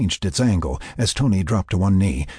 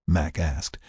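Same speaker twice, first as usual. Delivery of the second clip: usual speaking voice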